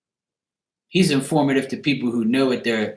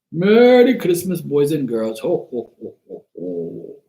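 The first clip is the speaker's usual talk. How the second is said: Santa voice; 3 semitones higher than usual. Pretend. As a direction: play Santa greeting the kids. Santa greeting with hat.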